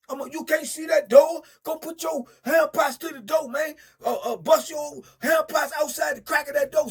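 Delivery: loud, high enraged yelling; retold cellmate rage; furious shouting. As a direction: tear into him. Rip raging at his celly.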